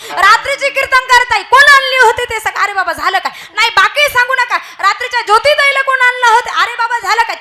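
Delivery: husband bitten, yelling at his wife, loud and high; angry shouting. Acted. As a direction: yell at him in pain and fury. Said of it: "मोठ्यानं ओरडायला लागलं".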